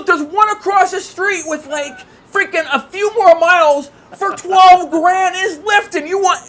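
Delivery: high, drawn-out, loud protest; acted incredulity. Buyer to car seller about cheaper Jeep across the street, high incredulous protest. Acted haggling over the asking price.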